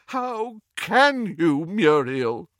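slow, pained groan; groaning reproach. A narrator's reading. Old friend reproaching Muriel, slow pained groan. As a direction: let it ache out of you. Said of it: "groaned Doge".

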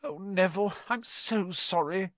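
miserable. A narrator's reading. Hermione to petrified Neville, miserably sorry. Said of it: "said Hermione miserably".

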